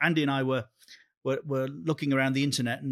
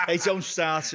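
put-on Liverpool accent; 4 semitones higher, slower than usual. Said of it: "lost your Liverpool accent".

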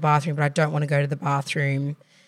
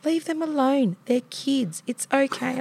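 imitating auntie, higher and casual; nonchalant auntie; 9 semitones higher than usual. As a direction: brush it off, much higher.

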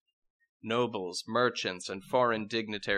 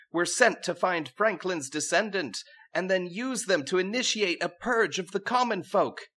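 put-on higher and higher, bratty young woman; princess voice; 8 semitones higher than usual. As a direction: climb in pitch as she rants. Princess accuses the king.

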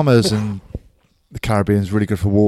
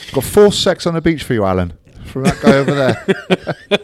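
mock barman; 5 semitones higher, 6 dB louder than usual. Barman voiced in retold story.

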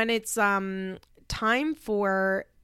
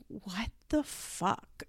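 stunned mutter; 9 dB quieter, much slower than usual. Retold or imitated. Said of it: "Simon's like".

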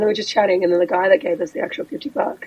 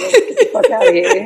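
high-pitched, half-laughing urgency; retold urgent escape; 12 semitones higher, 3 dB louder than usual. Woman telling friends to leave, laughing urgency. Retold urge to flee, amused.

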